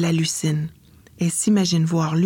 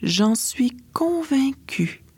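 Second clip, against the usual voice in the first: hushed fairy; 6 semitones higher, much slower than usual. Acted. Fairy godmother speaks.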